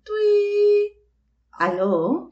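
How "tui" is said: high squeaky imitation of a phone ring. Sisters retelling a call, imitating the high phone ring. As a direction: chirp it like an old phone.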